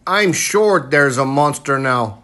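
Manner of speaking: ordinary delivery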